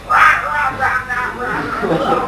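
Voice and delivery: high garbled ranting noise, falling gradually; mock wordless cursing. Patient cursing in a wheelchair, mock wordless rant. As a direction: imitate a ranting curser with nonsense barks. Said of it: "just cursing, cursing".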